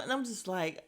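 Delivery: ordinary talk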